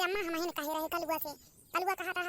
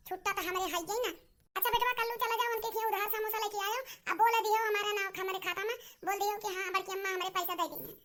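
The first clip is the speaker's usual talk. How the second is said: ordinary delivery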